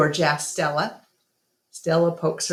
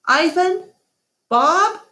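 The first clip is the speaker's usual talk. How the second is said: higher, louder little Ruby calling out; high child calling; 14 semitones higher, 5 dB louder than usual. Acted. Little Ruby calling her sleeping friends at dawn. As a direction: call out to wake them like an eager child.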